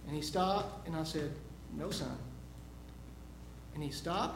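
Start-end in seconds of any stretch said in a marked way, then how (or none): 1.8-2.2 put-on low, hushed warning to a child; stern no. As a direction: quietly warn a toddler off, voice dropped. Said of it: "he hadn't heard that tone before".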